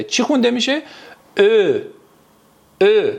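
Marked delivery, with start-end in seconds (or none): 1.4-3.1 «ا» as ö, "e" with rounded lips; Turkish ö sound. Shown as a demonstration. Teacher shows students the surprised-face letter, rounded ö. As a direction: say «ا» as a rounded front ö, twice. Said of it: "به این شکل".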